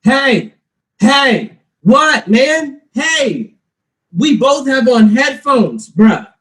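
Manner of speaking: hammed-up shouting at his son; imitating dad yelling. Dad yelling at his son while shoveling snow, mock shout. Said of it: "'cause then you're screaming at me".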